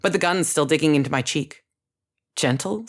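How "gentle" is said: usual speaking voice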